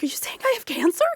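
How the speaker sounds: high, fast, acted alarm; flipping out. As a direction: blurt it as a panicked question. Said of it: "I'm flipping out".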